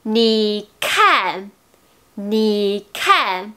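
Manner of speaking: "ni kan" with a strong puff of air on the K; aspirated K. Shown as a demonstration. Teacher asking learners what they said, aspirated K in "ni kan". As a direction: burst breath out on "kan". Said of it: "est le son d'expiration".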